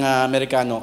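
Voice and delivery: ordinary talk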